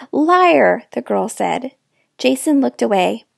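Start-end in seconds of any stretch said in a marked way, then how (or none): 0.1-0.8 sharp, loud, higher "Liar"; accusing. A narrator's reading. Girl accusing the narrator of lying, loud and sharp. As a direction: spit it at her.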